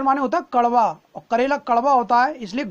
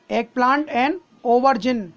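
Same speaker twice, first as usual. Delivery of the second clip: slow teaching repeat; slower than usual. Shown as a demonstration. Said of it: "दो और मैं आपको बता देता हूँ".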